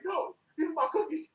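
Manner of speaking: retold indignant outburst; angry insistence. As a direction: insist these are yours. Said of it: "Just, just angry".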